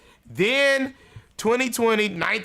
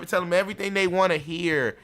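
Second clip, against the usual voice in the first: usual speaking voice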